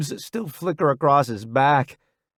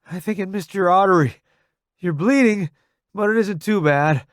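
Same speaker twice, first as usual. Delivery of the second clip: higher, urgent delivery; raised-voice assessment; 6 semitones higher, 4 dB louder than usual. A narrator's reading. Newbie checking Roddy's wound, raised voice. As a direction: report the wound over the gunfire.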